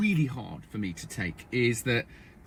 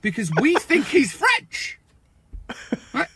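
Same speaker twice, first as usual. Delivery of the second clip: higher, slow, emphatic; mock-indignant; 7 semitones higher, 3 dB louder, slower than usual. Real, not acted. Brit mocking English snobs, high and slow. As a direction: exaggerate the snobbery.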